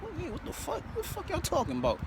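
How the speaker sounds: high, falsetto mock confusion; baffled denial. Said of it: "I'm like".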